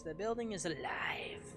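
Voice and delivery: hushed, spooky whisper; eerie whisper. Acted. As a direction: whisper it like a creepy secret. Reading a creepy note aloud.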